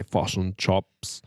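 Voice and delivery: low-key Kiwi vowels; New Zealand accent. Shown as a demonstration. Showing how New Zealanders say it, Kiwi accent. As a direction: say "chips" as Kiwi "chops", at an ordinary pitch. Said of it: "And we say".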